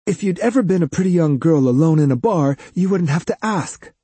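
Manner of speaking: put-on sharp, irritated young woman snapping back. A narrator's reading. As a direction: bite off each word, annoyed. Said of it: "she snapped".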